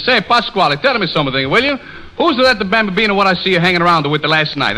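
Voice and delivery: high-pitched comic Italian; mock Italian accent. Tony asking Pasquale about last night, mock Italian accent. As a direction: add stage Italian lilt and extra vowels. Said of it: "Tony and Pasquale".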